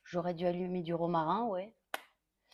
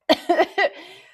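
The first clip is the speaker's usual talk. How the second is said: high, loud choking cough; 9 semitones higher, 11 dB louder than usual. Real, not acted. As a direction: cough as if food went down wrong. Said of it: "J'ai fait une fausse route".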